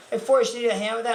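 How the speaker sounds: casual offer. Retold or imitated. Girl offers help with unwrapping, imitated breezy offer. Girl's first, literal-sounding offer.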